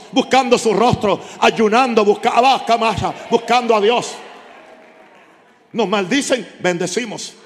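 impassioned revival preaching; fervent preaching. Acted. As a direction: drive the word home like a pulpit cry. Preacher builds the humility call.

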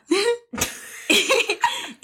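high burst of laughter; laugh at own blunder. Real, not acted. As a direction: crack up after botching a word. Cracking up after botching the title.

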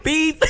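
high-pitched mock censor beep; censor bleep. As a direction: imitate a bleep censoring a name. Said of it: "I wanna bleep his name out".

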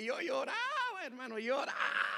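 hammed-up high lament; imitated wailing. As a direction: stretch the word into a long cry. Re-enacts his crying in church.

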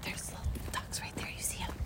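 hushed whisper about the ducks; real whisper near ducks. Real, not acted. Whispering not to scare ducks.

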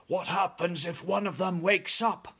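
a touch of knee-knocking fear; fearful whisper, lightly acted. A narrator's reading. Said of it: "whispered the head of the army".

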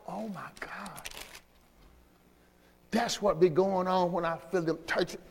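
hushed breathy whisper of wonder; awed whisper. Real, not acted. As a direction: breathe it out in awe. Preacher whispering in awe.

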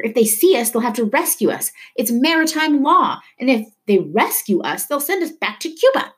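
high-pitched, emphatic yelling; heated yell. A narrator's reading. Poppy warns Leto about being rescued, yelling. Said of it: "he yelled over the growing thunder".

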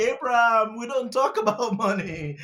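playfully imitating coy planners; high, laughing imitation of planners waving money talk away; mock planners' protest. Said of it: "they say".

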